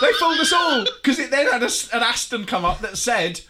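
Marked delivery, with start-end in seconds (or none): 0.0-2.5 high, loud laughing outburst; laughing shout. Real, not acted. Host laughs at how the show fooled viewers, loud and high. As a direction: blurt it out through a laugh.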